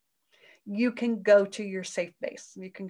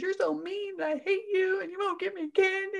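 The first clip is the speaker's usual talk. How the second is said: put-on much higher, whiny child complaint; angry child; 10 semitones higher than usual. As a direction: play the sulking kid denied candy. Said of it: "she's making up all kinds of stories".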